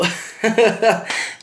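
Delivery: high, loud burst of laughter; loud mocking laugh; laughing at someone. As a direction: crack up helplessly. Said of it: "I'm just laughing at him".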